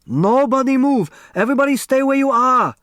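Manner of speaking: loud high panicked shout, the second line fast; frantic commanding shout. Acted. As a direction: bark out the order in alarm. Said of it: "Mister Hu shouted".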